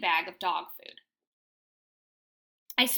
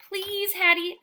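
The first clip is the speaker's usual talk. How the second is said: high, louder begging whine; whining plea; 9 semitones higher, 5 dB louder, slower than usual. A narrator's reading. Dog pleading with Hattie for food, high whine. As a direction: beg like a starving pup. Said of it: "I whine".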